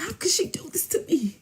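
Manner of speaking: soft, very high put-on whimper; weird mock lament. Man lamenting about Jessica, soft mock lament. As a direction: mimic his self-pity in a small high voice. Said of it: "he started doing weird stuff".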